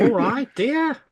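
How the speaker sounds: high, mock Australian "all right, dear"; bad Australian accent. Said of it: "that terrible Australian accent there".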